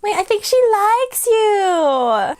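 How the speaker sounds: high, drawn-out gushing; sing-song high-pitched delight; delighted cooing. Real, not acted. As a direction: coo over a budding friendship, stretching the words. Cooing over dragon befriending rider.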